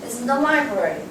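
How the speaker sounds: only library stressed, the rest light. Shown as a demonstration. Teacher models neutral sentence stress. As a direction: land the stress on library alone. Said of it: "강세는 여기만 들어가야 돼요".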